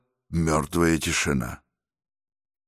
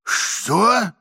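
a sharp, higher threatening "what"; menacing. Acted. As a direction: bark it as a threat. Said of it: "сказал мужчина в кепочке грозно".

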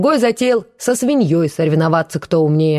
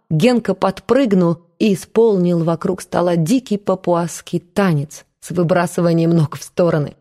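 usual speaking voice

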